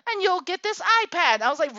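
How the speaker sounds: usual speaking voice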